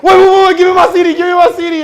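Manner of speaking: high, loud, desperate pleading; frantic shout. Retold or imitated. Begging the friend not to throw his CD out, frantic shout. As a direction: yell to save the CD.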